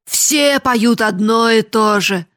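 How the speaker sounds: loud, slow outcry; shouting. Acted. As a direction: drag it out loudly in despair. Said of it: "закричал Гранде".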